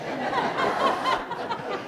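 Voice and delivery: breathy unvoiced laugh; a quick breathless chuckle; airy laugh. Real, not acted. Preacher laughing at the odd skit, airy laugh.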